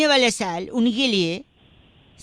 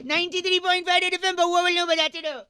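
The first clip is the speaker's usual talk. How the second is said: radio sign-off; 6 semitones higher, 4 dB quieter, faster than usual. Acted. Radio prank call sign-off jingle.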